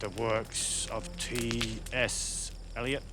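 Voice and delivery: very slow, low, ponderous film-star voice; Marlon. Retold or imitated. Actor playing a weary madman to his visitor, very slow and low. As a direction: drag it out low, with heavy pauses.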